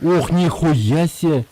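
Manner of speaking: high, slow amazed outburst; genuinely impressed gasp of surprise; surprised exclamation. Real, not acted. Sees the new skill. (удивлённо, с восторгом)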